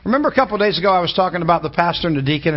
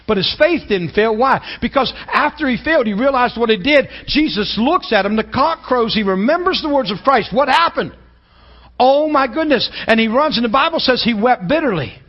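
rising preaching; 6 semitones higher than usual. Acted. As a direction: lift the congregation, voice climbing. Preaching builds up.

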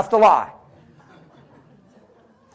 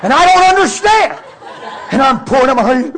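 loud retold bafflement; 7 semitones higher, 10 dB louder than usual. Retells baffled outpouring as friend cries.

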